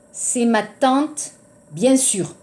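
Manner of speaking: normal talking voice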